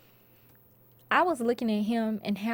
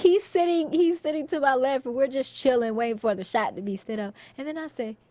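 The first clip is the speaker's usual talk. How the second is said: ordinary delivery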